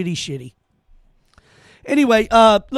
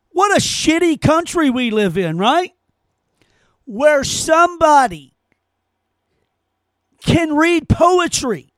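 higher, mock-indignant sarcasm; sarcastic mock outrage; 4 semitones higher than usual. Host mocking singer's riches, sarcastic mock outrage. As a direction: feign outrage at her success.

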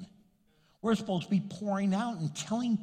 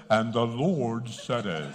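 low, slow, mock-solemn preacher; pulpit voice; 7 semitones lower than usual. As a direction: intone it like a pompous pulpit preacher. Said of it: "you ought to get a pulpit voice".

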